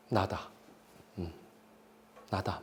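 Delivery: villain quietly admitting his identity; hushed confession. Retold or imitated. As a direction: confess it in a near whisper.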